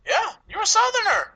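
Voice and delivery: high, loud teasing jab; playful insistence. Real, not acted. Teasing the Ohio co-host about being a Southerner, loud high insistence. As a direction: pitch it way up and tease loudly.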